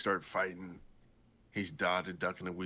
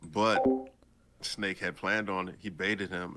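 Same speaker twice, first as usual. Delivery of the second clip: normal talking voice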